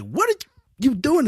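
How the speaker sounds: mock the mother's high angry snap. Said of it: "she was ready to smash".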